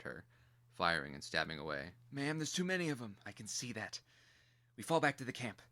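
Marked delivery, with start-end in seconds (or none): 2.1-3.2 higher soldier voice, urgent; anxious soldier. Acted. Toy soldier warning the general, anxious and higher. 3.3-4.0 curt general. Acted. 4.8-5.6 higher, louder order to withdraw; commanding retreat. Acted. General ordering her lifeguard back to camp, firm and loud.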